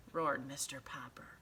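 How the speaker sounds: usual speaking voice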